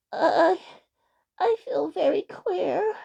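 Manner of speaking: high, slow, troubled elf voice; hesitate, then own up in a high plaintive voice; queasy little elf. A narrator's reading. Unwell elf tells friends his trouble.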